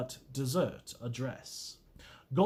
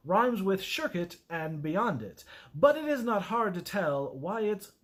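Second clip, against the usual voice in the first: ordinary talk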